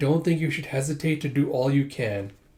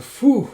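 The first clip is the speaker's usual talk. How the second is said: relieved phew; 10 semitones higher, 8 dB louder than usual. Retold or imitated. Relieved exhale.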